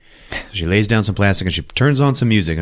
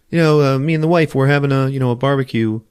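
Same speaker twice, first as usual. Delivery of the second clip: fast eager guy; 6 semitones higher, faster than usual. Retold or imitated. Neighbor pushing invite.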